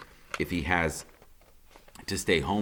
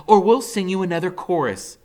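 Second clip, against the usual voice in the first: mother's voice; 12 semitones higher, 10 dB louder than usual. A narrator's reading. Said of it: "the Fair Gwen says".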